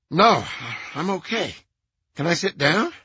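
high, nasal, shaky; breathless and high, recovering. A narrator's reading. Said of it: "High-pitched, nasal voice".

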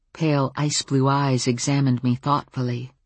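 ordinary delivery

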